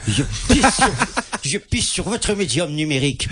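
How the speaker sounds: haughty Cannes aristocrat sneering; aristocratic french. Pretend. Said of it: "c'est sa voix de résident de Cannes".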